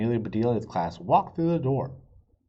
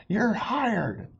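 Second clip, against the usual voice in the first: high, bright boss voice; a boss proudly announcing; high proud announcement; excited boss; 10 semitones higher than usual. A narrator's reading. Pete hires her, voiced character.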